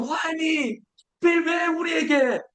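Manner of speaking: high, slow whining complaint; high plaintive why me whine; complaining Israelites. Pretend. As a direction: whine like a grumbling crowd.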